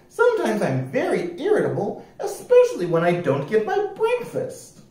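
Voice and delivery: high, prim and proper voice; un-piratelike rascal. A narrator's reading. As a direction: explain it very properly, pitched high. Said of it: "said the rascal".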